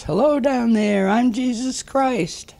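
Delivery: high, drawn-out holler from above. Acted. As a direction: call down from way up, stretching the words out. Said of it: "And he hollers".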